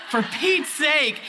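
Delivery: exasperated. Retold or imitated. Exasperated at waiting.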